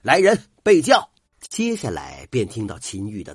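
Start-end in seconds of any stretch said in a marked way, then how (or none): 0.0-1.1 bellowed order, much louder and higher; loud call. Acted. Official summoning servants, commanding loud shout. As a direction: yell for the servants at full force. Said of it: "大叫了一声".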